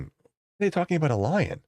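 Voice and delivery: high-pitched imitation of the coworker. Teller mimics Nigerian coworker asking about the call, high mock voice. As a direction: pitch way up to play him.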